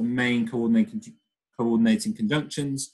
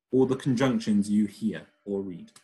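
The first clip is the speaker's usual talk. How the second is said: ordinary talk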